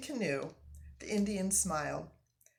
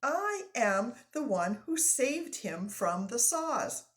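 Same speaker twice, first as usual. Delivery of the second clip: loud, high and jubilant; crowing with delight; French-Canadian accent, overjoyed; overjoyed lumberjack; 5 semitones higher, 7 dB louder than usual. Acted. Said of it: "He was dancing up and down".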